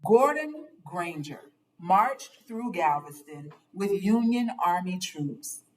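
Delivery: ordinary delivery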